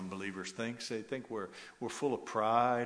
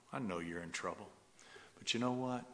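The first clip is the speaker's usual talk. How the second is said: ordinary talk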